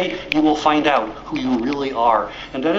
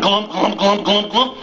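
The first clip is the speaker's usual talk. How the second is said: clumsy footsteps; 2 semitones higher than usual. Acted. Clumsy moose-duck running.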